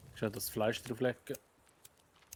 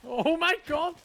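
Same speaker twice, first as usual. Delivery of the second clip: much higher, loud laughing shriek; 12 dB louder, slower than usual. Real, not acted. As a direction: burst out laughing in disbelief.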